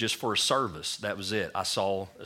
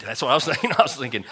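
laughing while telling it, louder; 4 semitones higher, 8 dB louder, faster than usual. Real, not acted. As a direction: laugh through the words. Laughing at his own doubt.

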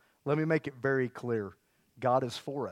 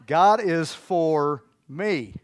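louder, slow, God punched; loud slow declaration; 7 dB louder, much slower than usual. Shown as a demonstration. Pastor leading the final repetition, slow and loud. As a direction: shout it out slowly with full weight. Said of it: "One more".

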